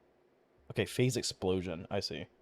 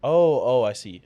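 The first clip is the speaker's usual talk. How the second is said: loud, startled "oh, oh"; sudden realization; 10 dB louder than usual. Real, not acted. Streamer figuring out the skill choice, loud realization. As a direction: burst out as it suddenly clicks.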